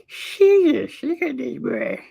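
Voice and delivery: high, astonished exclaim; amazed. Real, not acted. Streamer gawks at a huge dog picture, amazed high voice. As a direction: marvel at the size of it.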